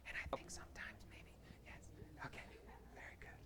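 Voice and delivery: barely audible whisper; too-quiet talk. Shown as a demonstration. Host testing the group's volume signal, whispering far too quietly. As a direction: murmur it almost without voice.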